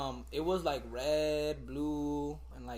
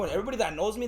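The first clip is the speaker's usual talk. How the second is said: ordinary talk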